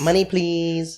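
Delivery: high, drawn-out sing-song begging; mock spoiled girl. Joining friends' teasing of those chicks, mock spoiled-girl voice. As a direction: stretch it out in a high put-on voice.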